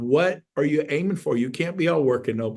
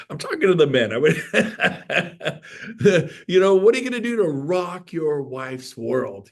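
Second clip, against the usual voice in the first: ordinary talk, nothing marked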